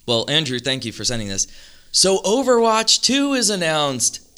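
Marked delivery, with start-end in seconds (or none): 1.9-4.3 high, slow, hyped-up reveal; dramatic announcement. Real, not acted. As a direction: announce it like big news.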